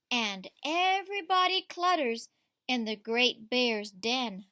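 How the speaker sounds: lively read-aloud to a class; story reading to children. A narrator's reading. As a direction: read it like a picture book at story time. Teacher reads picture book aloud.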